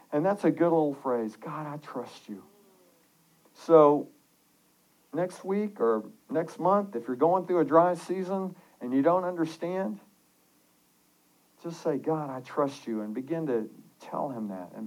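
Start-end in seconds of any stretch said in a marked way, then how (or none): 1.4-2.4 soft, hushed trust; quiet prayer. Acted. Preacher offering a phrase to pray, softly. 12.0-13.0 soft, low words of trust; quiet prayer. Acted. Preacher telling listeners what to pray, softly.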